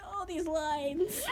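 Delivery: ordinary delivery, nothing marked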